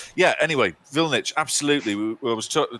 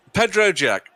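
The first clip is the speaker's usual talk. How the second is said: calling out; 12 semitones higher, 6 dB louder than usual. Real, not acted. Calling out a chat member.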